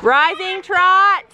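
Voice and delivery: slow, loud arena call; slow projected riding command. Pretend. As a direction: draw it out across the pool. Judge calls command.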